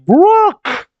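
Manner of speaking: high-pitched, drawn-out cry of a name; bursting-in cry. Acted. Someone bursts in on Brook, high urgent cry. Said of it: "someone bursts in".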